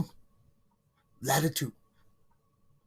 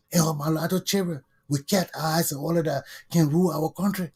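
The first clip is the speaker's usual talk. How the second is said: ordinary delivery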